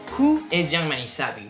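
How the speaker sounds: ordinary delivery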